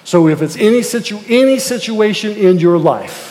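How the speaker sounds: full-force pulpit exhortation; fiery preaching. Acted. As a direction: preach it hard to the congregation.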